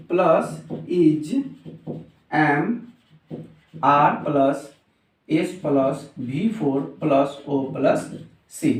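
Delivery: item by item with pauses; slow dictation. Shown as a demonstration. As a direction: read out a formula while writing it. Writes out the formula piece by piece. (रुक-रुक कर लिखवाते हुए)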